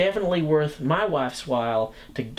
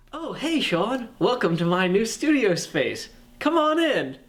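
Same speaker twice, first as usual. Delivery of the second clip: high, bright showman greeting, much higher pitch; hammed-up host welcome; 7 semitones higher than usual. Hosting video tour, hammed up.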